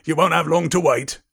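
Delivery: loud, hearty character voice; Hagrid voice. Acted. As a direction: put on a big friendly character's voice. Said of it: "Hagrid voice. I haven't done Hagrid voice".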